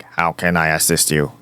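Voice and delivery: low, flat robotic mimic; imitating a stiff robot helper. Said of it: "talking like a robot".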